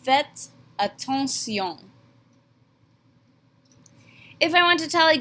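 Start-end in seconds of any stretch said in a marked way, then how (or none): 0.1-1.9 Faites attention drawn out, French vowels; teacher models the command slowly; slow French demo. Shown as a demonstration. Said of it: "We just pronounce it differently".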